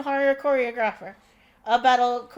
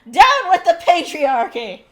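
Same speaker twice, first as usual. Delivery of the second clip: loud, slow, hammed-up rally shout; a booming mock battle cry; Magneto mock rally cry; 5 semitones higher, 9 dB louder, slower than usual. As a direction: bellow it like a pompous revolutionary. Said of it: "But he's just like".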